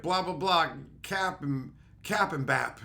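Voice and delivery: lightly mimicked teen babble; mock kid slang. Imitating the kid who spoke kid language to him. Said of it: "talking to me in kid language".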